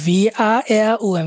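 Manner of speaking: drawn-out letter names ভি আ এ উ এম; letter-by-letter spelling. Shown as a demonstration. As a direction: spell warum slowly as ভি আ এ উ এম.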